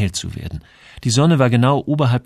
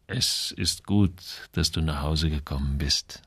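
soft, low welcome, starting very slowly; ailing old seaman; 5 semitones lower, 6 dB quieter, slower than usual. A narrator's reading. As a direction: welcome him home quietly, as a sick old man. Sick old Samuel welcomes Joel home. (leise, tiefe Stimme des kranken Alten)

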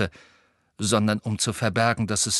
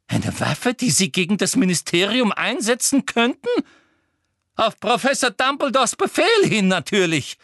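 high, louder, greedy Umbridge; excited; 11 semitones higher, 4 dB louder than usual. A narrator's reading. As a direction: she smells a conspiracy. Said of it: "vor Aufregung aus den Höhlen". (Umbridge aufgeregt und gierig)

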